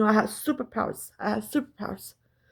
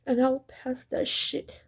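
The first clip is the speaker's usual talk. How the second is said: usual speaking voice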